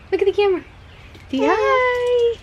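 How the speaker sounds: high sing-song coaxing. Real, not acted. Mother coaxing her baby to wave at viewers, high sing-song.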